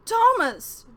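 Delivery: very loud, high scream of the name. Acted. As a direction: scream his name in horror. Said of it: "Talgith was screaming now".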